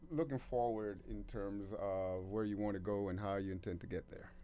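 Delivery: ordinary delivery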